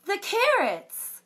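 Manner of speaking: high, loud startled cry; shocked mama. Acted. Mama finding the cupboard empty, high and alarmed. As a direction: gasp it out as a high alarmed mother.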